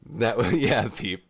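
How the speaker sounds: laughing through the words; chuckling mid-sentence. Real, not acted. Laughing at a close race.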